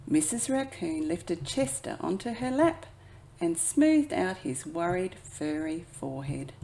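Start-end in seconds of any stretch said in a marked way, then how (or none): none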